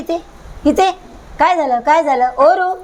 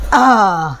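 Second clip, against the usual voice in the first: ordinary talk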